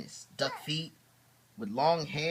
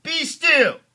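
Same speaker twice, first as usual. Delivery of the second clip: higher, louder stern command; commanding Max; 14 dB louder than usual. Acted. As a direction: order the monsters to freeze. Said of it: "He said".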